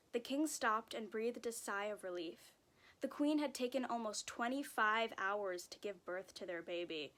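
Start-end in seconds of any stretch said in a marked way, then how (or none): none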